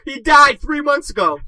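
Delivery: high, loud cry through laughter; laughing outburst. Real, not acted. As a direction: blurt it out while cracking up. Bursting out after own punchline.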